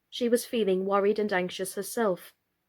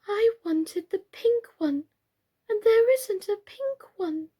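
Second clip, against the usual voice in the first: put-on very high, small childish lament; disappointed Birdie; 12 semitones higher than usual. A narrator's reading. Birdie mourning the pink carpet, very high and childish.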